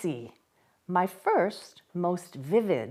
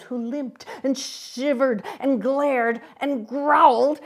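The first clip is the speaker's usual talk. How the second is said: plain reading voice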